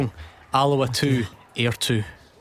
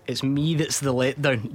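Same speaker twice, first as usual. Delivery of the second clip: ordinary talk, nothing marked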